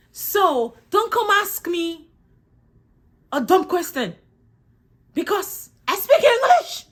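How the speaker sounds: high, emphatic telling-off; mock indignant scolding. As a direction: scold them, climbing to a loud high finish. Mock scolding of those asking.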